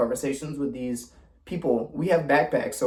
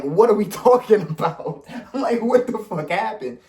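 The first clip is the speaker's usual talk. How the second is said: retold bafflement; 6 semitones higher than usual. Retold bafflement at her sudden anger.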